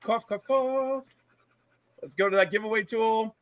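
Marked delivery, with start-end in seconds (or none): 0.0-1.1 slow spoken mock coughs; fake cough. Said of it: "As we all cough".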